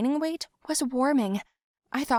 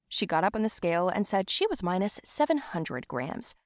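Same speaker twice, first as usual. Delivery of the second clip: ordinary delivery, nothing marked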